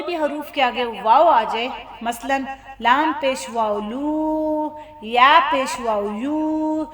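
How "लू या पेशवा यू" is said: slow spelling, then hold "लू" and "यू" long; lengthened "oo". Shown as a demonstration. Teacher shows waw lengthening the vowel, drawn-out "लू, यू". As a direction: draw out the final vowel of "लू" and "यू" on one note. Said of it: "थोड़ा सा लंबा करना है".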